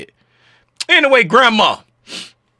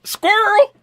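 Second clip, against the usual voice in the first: squirrel shout. Pretend. Sudden distraction call-out.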